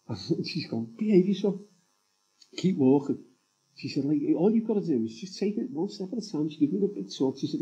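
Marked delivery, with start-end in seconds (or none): 1.0-3.2 higher, playful scolding as his wife; lightly mock-stern wife voice; a hint of a no-nonsense wife; wife's brisk telling off. Wife firmly dismisses worry. 4.4-6.5 higher, patient coaxing voice of his wife; lightly voiced wife, one step at a time; a touch of wifely encouragement; wife's pep talk. Wife gives the big talk.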